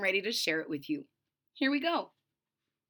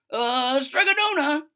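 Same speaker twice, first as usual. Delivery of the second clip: loud, higher shout; 4 semitones higher, 7 dB louder than usual. Acted. Big Anthony running in, shouting for the witch. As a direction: burst in calling her. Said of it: "he shouted".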